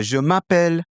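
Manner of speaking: high French-accented je m'appelle; native French accent. Shown as a demonstration. Teacher switching into Clément, native French accent. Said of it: "now I'm adopting that character".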